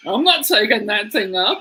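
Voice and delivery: mock the concierge's outraged no; retold furious refusal. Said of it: "she was furious".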